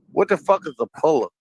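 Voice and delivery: ordinary talk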